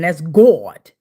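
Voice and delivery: "God" drawled long with an aw; long, emphatic "Gawd" for "God". Real, not acted. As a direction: say "God" slowly, as if spelt G-A-W-D. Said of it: "with the G A W D".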